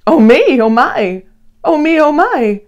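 wide-eyed read-aloud wonder; excited exclaiming. A narrator's reading. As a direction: marvel at everything going by. Narrator exclaims at passing creatures.

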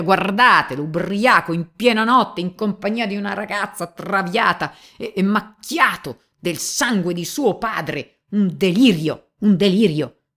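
very high, loud, indignant cry; outraged exclamation. Acted. Police chief to the gentlemen, outraged shout. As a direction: explode at the whole room. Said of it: "esclamava il capo della polizia".

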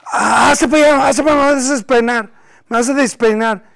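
loud, high retold motherly brush-off; rebuffing mother. Mother pushes away her son's hug, loud high rebuff. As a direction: shoo a hugging child away loudly. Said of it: "la trataba de abrazar y besar y decía".

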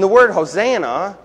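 normal talking voice

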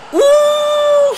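high loud whoop; high excited whoop. Real, not acted. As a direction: whoop as the shot flies. Shot almost goes in.

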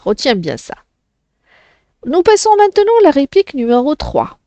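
usual speaking voice

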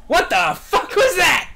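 high, loud, slow shocked outburst; shocked shriek. Real, not acted. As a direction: blurt it out in disbelief. Shocked by sudden event.

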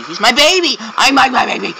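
high-pitched playful outburst; hammed-up, high doting cry; mock gushing. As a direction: squeal over it like a precious baby. Gushing over his stalker sensor.

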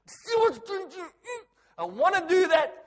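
high, loud comic outburst; laughing mock rant. Pastor joking to congregation, high and laughing. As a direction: rant in jest, cracking up.

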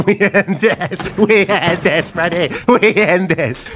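high, slow, stretched-out declaration; dramatic battle cry. Pretend. Player declaring war on Freddy, high drawn-out cry. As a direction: draw each word out high.

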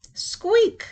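high squeak; a high creaky door squeak; squeaky door. A narrator's reading. Door sound effect in story.

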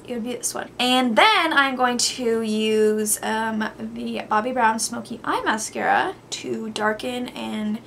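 ordinary talk

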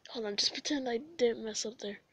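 ordinary talk

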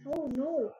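loud startled oh no; alarmed shout. Real, not acted. Zombies appear suddenly.

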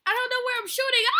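high, loud and fast; panicked yell. Real, not acted. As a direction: gabble it loudly in panic. Shooting wildly while chased.

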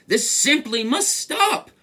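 high, slow, indignant shout; high-pitched storybook outburst; mouse's shout. A narrator's reading. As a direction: demand it, each word drawn out. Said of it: "he sat up and shouted".

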